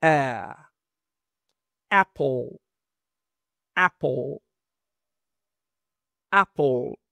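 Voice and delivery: "Apple" with a wide ae; open ae demo. Shown as a demonstration. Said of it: "como una A muy abierta". ("Apple" con A muy abierta)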